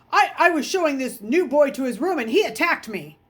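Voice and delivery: high, loud tattling whine; whiny boy. A narrator's reading. Bully lies to the teacher, high whiny tattle. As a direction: play the wronged innocent. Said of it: "Raleigh said".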